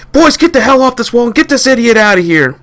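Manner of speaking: high, loud command; shouted order. Acted. Captain to his boys about the idiot: high shouted order. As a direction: order them off the wall.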